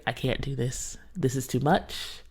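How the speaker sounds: low, very soft retold plea; overwhelmed aside. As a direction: confide weakly that you've had enough. Telling husband she can't go on.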